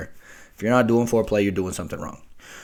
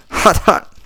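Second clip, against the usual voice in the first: hot coffee yelp; 11 semitones higher, 8 dB louder than usual. Real, not acted.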